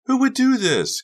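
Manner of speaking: high, loud, appalled question; appalled outcry. A narrator's reading. Carrie at the messed-up locker, appalled outcry. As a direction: cry it out in disbelief.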